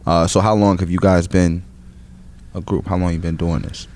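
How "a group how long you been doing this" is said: usual speaking voice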